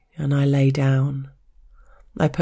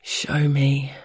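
hoarse. Acted. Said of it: "my voice was hoarse".

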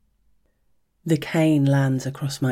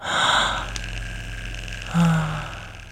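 contented sigh. Acted. After orgasm, melting.

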